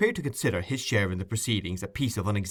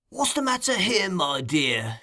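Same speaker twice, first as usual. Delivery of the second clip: put-on much higher, oily old man's voice; 9 semitones higher than usual. A narrator's reading. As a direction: greet them high and wheedling.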